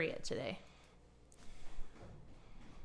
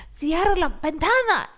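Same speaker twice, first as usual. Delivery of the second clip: loud Spanish command; 7 semitones higher, 7 dB louder than usual. Retold or imitated. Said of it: "And I'm like".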